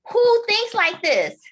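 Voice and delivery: high, loud, slowed disbelief; mock the husband's outraged who-thinks-like-this; incredulous high-pitched husband; husband's shocked quote. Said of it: "He said".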